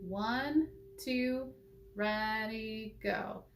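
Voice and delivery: teacher counting students in. Shown as a demonstration. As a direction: count them in gently. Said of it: "So you're gonna play that high E".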